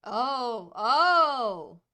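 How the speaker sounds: loud, rising gasp of realization; dawning realization. Acted. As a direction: gasp as it dawns. Character realizes who he faces.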